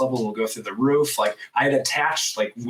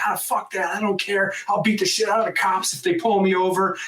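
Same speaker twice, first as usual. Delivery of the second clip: high, worked-up dismissal; retold defiance; 9 semitones higher than usual. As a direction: brush it off recklessly, pitched up. Retold defiant driver's reply.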